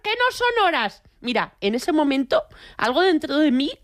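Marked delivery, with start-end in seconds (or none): 0.0-1.0 high-pitched scolding neighbour lady from the balcony; prim lady calling down from the balcony; shouted like an old lady. Retold or imitated. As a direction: scold the partygoers like a fussy señora. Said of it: "en posición de señora y grité".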